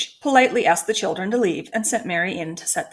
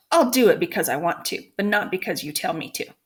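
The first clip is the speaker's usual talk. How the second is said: usual speaking voice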